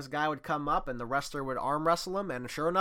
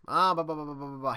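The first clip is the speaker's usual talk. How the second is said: mock yelling; 3 semitones higher, 5 dB louder, faster than usual. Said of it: "He'd be yelling, screaming, cursing".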